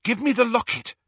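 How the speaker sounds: high, loud urging; impatient. A narrator's reading. Hermione demanding the locket from Harry, impatient. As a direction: hurry him along. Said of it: "she said impatiently".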